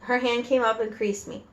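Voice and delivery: ordinary delivery, nothing marked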